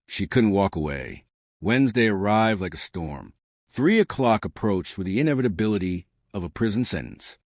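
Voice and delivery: plain reading voice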